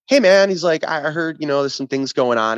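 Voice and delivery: sugary nice-guy email voice, lightly put on; mock nice guy. Mike sending a friendly email, mock nice guy. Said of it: "like, nice guy".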